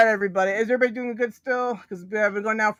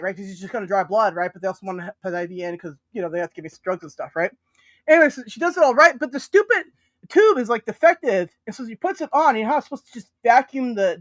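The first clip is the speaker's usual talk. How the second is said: normal talking voice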